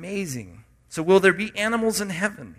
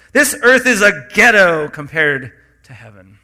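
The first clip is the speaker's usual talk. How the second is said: louder, bold imitation of a friend's saying; emphatic quote; 11 dB louder than usual. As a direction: punch it out with conviction. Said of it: "That's the way he said it".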